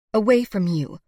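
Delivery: loud, curt retort; angry. A narrator's reading. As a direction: throw it over your shoulder. Sarah storms off.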